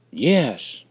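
put-on loud, proud answer from a boy. A narrator's reading. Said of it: "I spoke with great pride".

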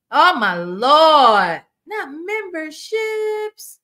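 very slow, drawn-out astonishment; amazed exclamation. Real, not acted. Streamer reacting to gifted memberships, drawn-out amazement. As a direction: stretch each word, loud at first, then climbing high.

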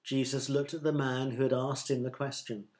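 ordinary delivery

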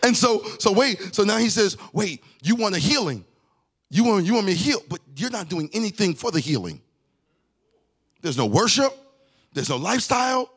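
high, ringing sermon delivery; preaching at full force. Real, not acted. Preacher driving the message to the congregation, full force. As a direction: lift it up and preach it.

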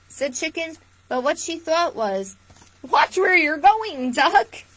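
lively storytime read-aloud; picture-book voice for little listeners; reading to children. Acted. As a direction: read it warmly to a room of kids. Reads picture book to viewers.

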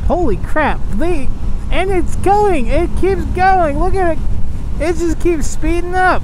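high, loud excited disbelief; rising thrill at the speeding car; amazed. Real, not acted.

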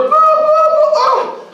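very high, loud mock blaring; imitated device noise. Preacher mimicking noise that distracts his prayer, high loud blare. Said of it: "I didn't like that noise".

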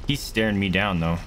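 ordinary talk

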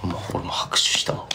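soft unvoiced whisper; whispered aside. Real, not acted. Whispering to fans that he clapped too. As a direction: drop to a whisper.

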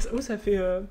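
ordinary talk

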